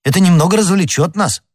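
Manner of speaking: high, quick, bright-eyed enthusiasm; eager exclamation. A narrator's reading. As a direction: blurt it out keenly. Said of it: "вскричал Д'Артаньян".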